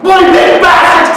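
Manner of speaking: very high, loud furious shout. Acted. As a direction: hurl the curse at her. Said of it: "I shout".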